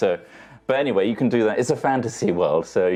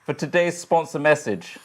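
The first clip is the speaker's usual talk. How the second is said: higher, projected address to the audience; mock-solemn announcement; 6 semitones higher than usual. Host answers critics before the sponsor message, mock-solemn announcement. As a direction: declare it grandly, like a public statement.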